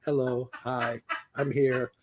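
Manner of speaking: low, soft monotone dub reader. Retold or imitated. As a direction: read it out in one unchanging tone. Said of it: "the same monotone voice".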